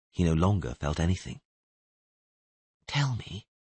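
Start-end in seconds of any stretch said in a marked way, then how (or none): 2.9-3.5 soft, quiet coaxing murmur; gentle soothing voice. Acted. Kneeling stranger coaxing a dying man to talk, softly. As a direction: lull him while pressing for names. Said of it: "the gentle voice kept repeating".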